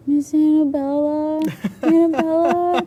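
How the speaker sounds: put-on high, slow little-girl plea, calling out; high camper voice. Girl calling her cabin leader at night, high pleading voice. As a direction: call her name like a needy kid.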